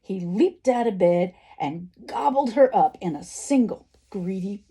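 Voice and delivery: lively, animated storybook narration; story reading to children. A narrator's reading. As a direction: read the action out with big emphasis. Reads a story aloud.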